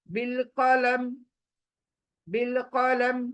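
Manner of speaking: बिल कलम closed on a silent meem; stopped meem. Shown as a demonstration. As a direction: drop the final i of बिल कलम. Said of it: "कलमी नहीं पढ़ेंगे".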